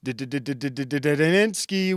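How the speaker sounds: rapid stuttered buildup on the name; stutter effect name. Acted. Greeting a chat viewer with a stuttered name callout.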